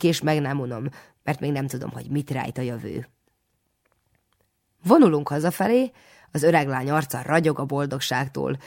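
plain reading voice

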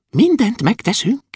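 high, thin little voice; dwarf promise. A narrator's reading. (magas, vékony törpehang)

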